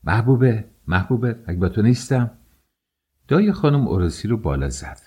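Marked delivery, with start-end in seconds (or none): 0.0-2.3 high, louder impatient calling; exasperated yell up the house; angry shout. A narrator's reading. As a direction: call out the name sharply, then scold. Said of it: "دوباره همچنان که به پله ها رسیده بود فریاد زد".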